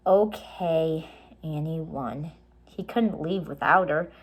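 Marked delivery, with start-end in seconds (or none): none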